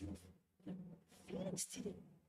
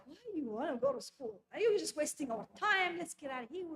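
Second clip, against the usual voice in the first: impatient scolding; 8 dB louder than usual. Retold or imitated. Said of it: "I was like".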